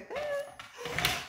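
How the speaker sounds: high bright giggle. Real, not acted. Parent giggling with a toddler.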